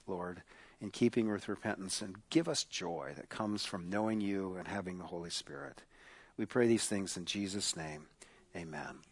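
low, soft praying voice; prayer. Real, not acted. Leads an opening prayer.

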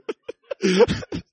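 sudden high, loud laugh; high burst of laughter. Real, not acted. Pastor laughing about his student frauds, high and loud. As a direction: let a big laugh burst out.

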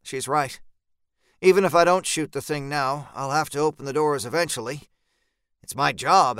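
plain reading voice

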